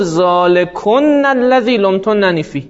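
ذالکن الذی لمتننی فیه recited slowly, doubled consonants held; slow Quranic recitation of ذالکن الذی; quoting the Quran. Shown as a demonstration. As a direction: recite ذالکن with a long held n, measured pace. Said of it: "گفت".

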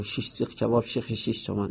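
rapid tongue-twister. Shown as a demonstration. Teacher showing a tongue-twister repeated in contests, rapid and soft. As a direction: rattle it off fast, under your breath.

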